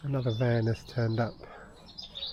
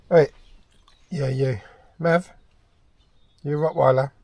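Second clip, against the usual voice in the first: talking to a dog; 6 dB louder than usual. Real, not acted.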